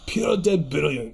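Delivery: slow, relished Scottish burr; playful Edinburgh Scottish accent. Pretend. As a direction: put on broad Scots, drawn out. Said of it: "I love his accent".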